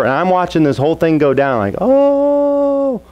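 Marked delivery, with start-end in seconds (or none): 1.8-3.0 high startled "Oh" gasp; a kid's high, stunned "Oh"; shocked oh. Retold or imitated. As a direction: gasp like you can't believe what you're seeing. Brother watches the showdown unfold.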